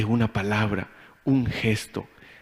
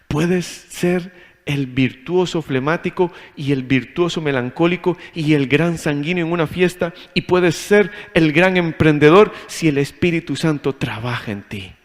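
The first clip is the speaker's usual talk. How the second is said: ordinary talk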